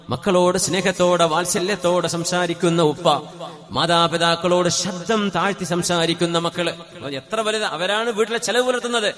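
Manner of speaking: preaching. Acted. Preacher telling families which home Allah loves, full-force sermon.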